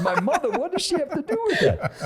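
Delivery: imitating one's own incredulous objection; retold bewildered protest. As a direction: squeak up in baffled protest. Retold protest about his mother.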